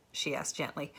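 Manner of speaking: ordinary delivery, nothing marked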